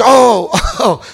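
a sudden high laughing "oh"; laughing shout. Retold or imitated. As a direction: burst out laughing mid-word. Cop reacting, laughing shout.

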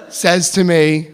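usual speaking voice

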